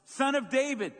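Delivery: loud high desperate cry. Acted. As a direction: cry out even harder. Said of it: "He only shouted louder".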